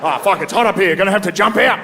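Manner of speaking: high-pitched comic Australian alarm; mock-distressed Australian office worker; Australian accent. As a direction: break bad news in broad Australian. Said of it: "an Australian guy in the office".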